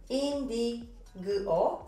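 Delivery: indigo very slowly in pieces, in-di-g-o; sounded out as spelled. Shown as a demonstration. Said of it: "se scrie exact cum scriem". (rar, pe bucăți, cum se scrie: indigo)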